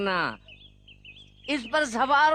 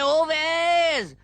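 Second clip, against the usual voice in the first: long, drawn-out call, higher and louder; 5 semitones higher, 3 dB louder, much slower than usual. Acted. Old Zubair calls after departing Owais, long drawn-out call. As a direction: stretch the name out to reach him.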